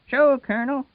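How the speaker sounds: Wash's voice. Acted. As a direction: answer up brightly to the Colonel.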